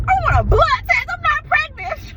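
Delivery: very high, loud wailing retelling; bawling. Retold or imitated. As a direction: wail it as if bawling at the doctor's. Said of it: "I'm bawling".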